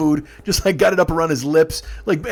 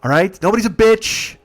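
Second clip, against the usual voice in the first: raised, louder laying down the law; stern reprimand; 5 semitones higher, 5 dB louder than usual. Retold or imitated. Driver scolding two fighting passengers, retold in a raised stern voice. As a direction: act out putting a firm stop to squabbling.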